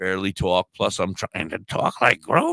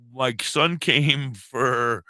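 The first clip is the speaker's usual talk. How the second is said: usual speaking voice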